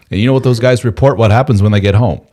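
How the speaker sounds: ordinary delivery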